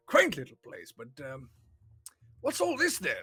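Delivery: high, loud, chuckling jolly wizard voice; delighted wizard. Acted. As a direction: gush cheerfully like a merry old mage. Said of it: "he's like".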